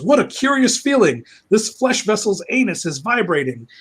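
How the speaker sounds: high-pitched, hammy wonder; mock fascinated villain. Villain puzzling over a borrowed body, mock fascinated. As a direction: marvel theatrically at an odd sensation.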